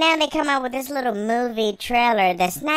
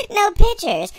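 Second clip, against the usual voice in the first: mock diva; 7 semitones higher, 8 dB louder than usual. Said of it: "walking outside saying".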